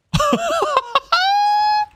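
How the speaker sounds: high villain cackle; evil cackle. Real, not acted. Villainous laugh.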